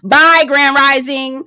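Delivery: slow, drawn-out mocking bye; drawn-out goodbye. Dismissing Grand Rising with a slow drawn bye. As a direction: stretch the bye, dismissing him. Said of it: "mock farewell, repeated".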